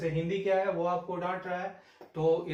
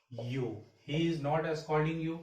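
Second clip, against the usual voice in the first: slow dictation; much slower than usual. Shown as a demonstration. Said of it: "teacher dictates the sentence".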